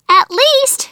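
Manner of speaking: put-on high, cheeky child's voice; giggly little girl. A narrator's reading. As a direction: pipe it up high as a giggling niece. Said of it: "But June giggled".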